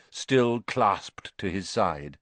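ordinary delivery, nothing marked

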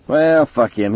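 high, loud brush-off; dismissive detective. A narrator's reading. Mason writing off Bannerman, loud and dismissive. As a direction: throw him out of the case, high and loud.

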